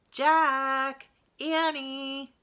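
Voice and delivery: high, loud call across a distance; calling from afar. A narrator's reading. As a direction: call out to the kids from far away. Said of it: "a voice called from the distance".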